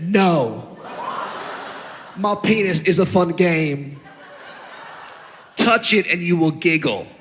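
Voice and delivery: lightly voiced solemn little boy, slow; imitated toddler. Three-year-old refusing Dad's order, lightly put-on slow voice. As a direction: deliver it slowly like a small child's proclamation. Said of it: "And he said".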